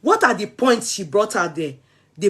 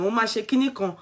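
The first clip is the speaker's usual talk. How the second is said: normal talking voice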